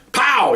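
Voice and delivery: high, sudden "pow" of the shot; imitated gunshot. Explaining the taser's shot, imitated bang. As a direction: mimic a firecracker going off. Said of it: "like a muffled gunshot kind of thing".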